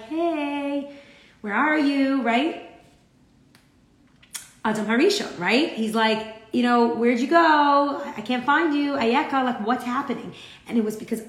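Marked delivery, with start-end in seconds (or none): 0.0-2.2 calling out. Acted. God calling for hiding Adam. 6.9-10.3 searching call. Acted. God searching, calling Adam.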